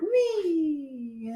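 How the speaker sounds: long drawn-out "wee"; a high, stretched "wee" like sliding along; swooping playful wee. Retold or imitated. Playfully imitating explorers' sea voyage.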